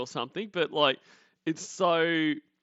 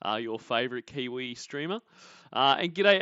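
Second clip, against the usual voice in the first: usual speaking voice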